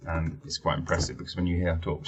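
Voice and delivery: ordinary delivery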